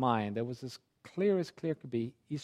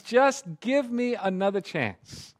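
slow begging plea; 6 semitones higher, 5 dB louder, slower than usual. Retold or imitated. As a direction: stretch each word as a last appeal. Grandpa repeats the plea slower.

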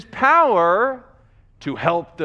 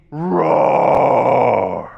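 low, loud drawn-out roar; lion's roar; 8 semitones lower, 6 dB louder than usual. Retold or imitated. Preacher imitates a distant lion for his illustration, roaring. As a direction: roar long and deep like a lion. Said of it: "you hear out in the forest somewhere a roar".

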